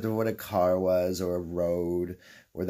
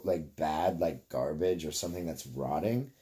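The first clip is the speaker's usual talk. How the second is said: ordinary delivery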